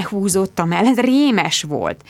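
ordinary delivery, nothing marked

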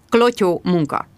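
usual speaking voice

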